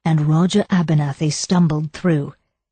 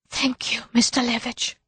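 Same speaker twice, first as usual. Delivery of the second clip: shy, much higher and much softer; a bashful thank-you, almost breathed; grieving woman shyly thanking a kind admirer; 6 semitones higher, 6 dB quieter than usual. A narrator's reading. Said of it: "Muriel spoke shyly".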